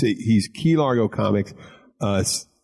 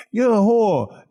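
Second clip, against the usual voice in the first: put-on Boston accent; 11 semitones higher, 4 dB louder, slower than usual. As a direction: shout it like a Philly cousin with a Boston accent. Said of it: "Now I'm doing a Boston accent".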